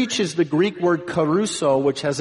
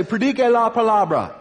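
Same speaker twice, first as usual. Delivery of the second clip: high, raised rallying cry; answering call; 8 semitones higher, 4 dB louder than usual. Retold or imitated. Preacher answering someone in the market square, answering call. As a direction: call the slogan back with gusto. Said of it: "And I say".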